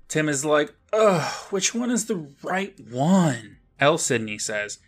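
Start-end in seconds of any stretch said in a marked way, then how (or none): 0.9-3.6 high, drawn-out acted groan of a question; exasperated. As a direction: whine it slowly as a stumped kid. Acted exasperated Tim facing many wands.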